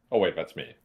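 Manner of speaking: normal talking voice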